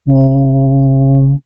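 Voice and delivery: ओम held very long on one level note; extra-long vowel. Shown as a demonstration. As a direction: stretch ओम to triple length, steady. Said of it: "ह्रस्व स्वर से तिगुना समय लगता है".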